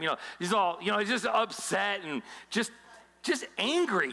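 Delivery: usual speaking voice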